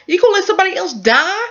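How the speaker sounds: high, fast incredulous question; mock outrage rising high in pitch; imitated disbelief. Man challenging a mother's choice, imitated incredulous question.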